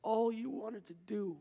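half-whispered wonder, gentle and awed. Retold or imitated. Forgiven dying man speaking gently to the Lord. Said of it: "suddenly he speaks gently".

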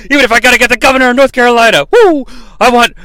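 loud, worked-up wrestling promo; Ric Flair. Acted. Wrestler threatens to call the boss, loud promo rant. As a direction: rant like a wrestler, ending in a whooping yell. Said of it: "excited to hear that Ric Flair".

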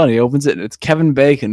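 ordinary delivery

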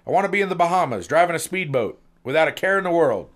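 fast, high and loud; dreamy wish. Acted. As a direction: rattle off the dream wish in a rush. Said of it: "said the admin clerk".

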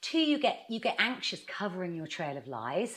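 normal talking voice

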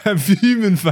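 high-pitched London echo, half laughing; Top Boy London accent. Pretend. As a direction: parrot it in mock London slang voice. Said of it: "doing some Top Boy shit".

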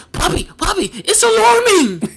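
put-on very high, loud child's cry; panicky little boy. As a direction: imitate a child bursting in alarmed. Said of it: "The boy comes running into the room".

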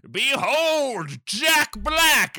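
high, loud drawn-out announcement; hammed-up heralding shout; booming herald. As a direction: herald a villain's grand entrance at full volume. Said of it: "Magic Koopa goes".

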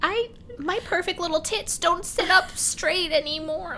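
high-pitched, self-pitying whine; mock whiny woman. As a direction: mimic her petty woe in a high voice. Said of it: "And you're just like".

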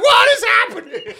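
very high, loud yell; hammed-up cry of alarm; mock panic. Caller being pulled out of a rock, mock-panicked.

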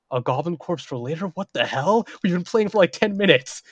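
mock-exasperated rise in pitch; incredulous. Game master inwardly reacts to player's odd request, incredulous.

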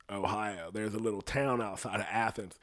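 usual speaking voice

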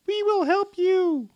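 tiny high fairy voice; a little sprite voice, high and light; twinkling breathy fairy whisper. Acted. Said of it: "you hear a little voice".

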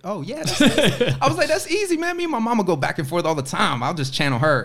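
high, loud, laughing swagger; laughing bravado. Retold or imitated. Telling the director he can yell, laughing and boastful. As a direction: laugh through a cocky boast. Cocky retold answer, laughing.

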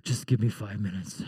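low, flat half-asleep mumble; grumpy not-yet-awake wife; deep sleepy "give me five minutes"; groggy wife. Retold or imitated. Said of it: "And she says".

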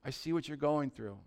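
soft, low, reassuring; quiet, supportive word to a brother; brotherly encouragement. Acted. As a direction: come alongside him gently. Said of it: "but just come to 'em like".

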